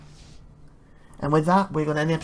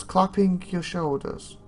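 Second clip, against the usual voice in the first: ordinary delivery, nothing marked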